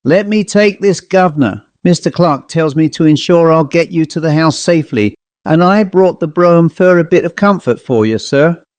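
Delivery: a chirpy Cockney coachman greeting his guest; cheery Cockney accent. Acted. Cockney servant Foster welcoming the visitor, cheery Cockney accent. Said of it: "said in a cheery Cockney accent".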